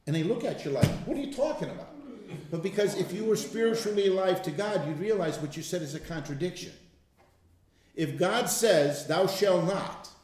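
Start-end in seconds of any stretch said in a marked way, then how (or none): 1.1-1.9 high, puzzled mock reply; mock baffled listener. Unbeliever to him, mock baffled. 8.0-10.1 slow, weighty "Thou shalt not"; slow emphasis. Shown as a demonstration. Quoting God to the listener, slow and weighty. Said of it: "slow emphatic scripture".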